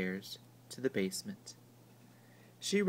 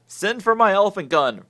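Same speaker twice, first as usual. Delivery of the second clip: loud roar, pitched up; big louder roar; 7 semitones higher, 12 dB louder than usual. A narrator's reading. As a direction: bellow it like a blustering colonel. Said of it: "roared Uncle Theodore".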